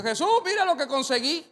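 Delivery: high, loud showing-off voice; Andrés proudly presenting the boy's food; proud boast. Retold or imitated. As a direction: brag to Jesus like it was yours.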